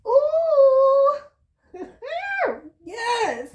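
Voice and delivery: sudden high, loud whoop into a laugh; a high squeal, a laugh, then a triumphant yes; excited whoop. Real, not acted. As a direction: burst out with real delight at the card. Card delights the reader.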